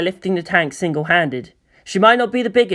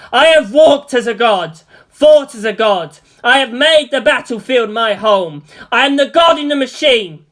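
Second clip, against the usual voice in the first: godlike Evangelion voice; 8 semitones higher, 5 dB louder than usual. A narrator's reading. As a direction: proclaim it like a god inside the machine. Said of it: "If this Evangelion had a voice, it would say".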